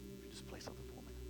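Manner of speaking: very low, quick whisper; whispered aside. Real, not acted. Preacher asks musician to play something, whispered. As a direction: slip in a quiet request under your breath.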